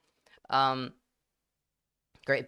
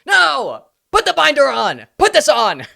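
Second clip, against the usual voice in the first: very loud, high-pitched shouted commands; mock panicked crowd; 11 semitones higher, 14 dB louder, slower than usual. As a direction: shout in hammed-up panic, like a scandalised mob. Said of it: "everyone clung onto my masculinity".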